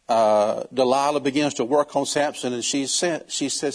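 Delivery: ordinary talk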